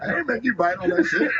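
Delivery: high, chuckling through the line; laughing. Real, not acted. Comic laughs at his joke.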